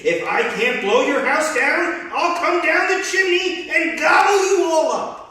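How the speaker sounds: loud, high furious shout. Acted. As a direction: roar it down the chimney. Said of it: "shouted down the chimney".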